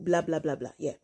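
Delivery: ordinary talk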